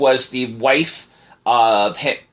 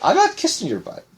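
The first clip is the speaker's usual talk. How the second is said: mock high voice; 13 semitones higher than usual. Joking banter about kissing butt.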